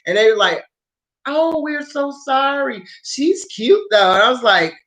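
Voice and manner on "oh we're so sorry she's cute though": higher, slow, syrupy apology; lightly voiced flirty strangers; mock sweet strangers. Mimicking the two strangers.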